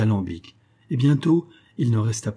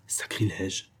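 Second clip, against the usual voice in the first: horrified murmur, much softer; low murmur; 3 semitones lower, 7 dB quieter, slower than usual. Acted. Hermann, horrified by the ashes, murmurs softly. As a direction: breathe the word out in dread. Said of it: "murmura Hermann à voix basse".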